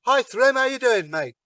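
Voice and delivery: high, loud cheery hello; breezy, over-friendly greeting to a giant; cheery Loki greeting. Acted.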